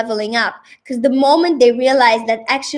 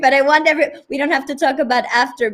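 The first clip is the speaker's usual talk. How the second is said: ordinary delivery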